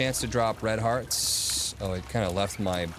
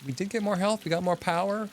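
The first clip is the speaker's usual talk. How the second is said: much higher, squeaky playful voice; high silly voice; 11 semitones higher than usual. Pretend. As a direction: pitch way up like a cartoon. Sudden high-pitched silly voice.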